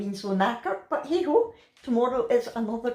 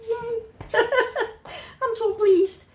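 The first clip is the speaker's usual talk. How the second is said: delighted; 13 semitones higher, slower than usual. Real, not acted. Delighted about chippy dinner.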